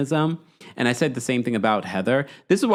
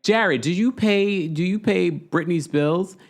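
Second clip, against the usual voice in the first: going in; 7 semitones higher, slower than usual. Retold or imitated. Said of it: "Bronwyn is going in".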